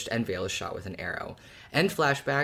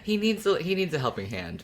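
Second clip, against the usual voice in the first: normal talking voice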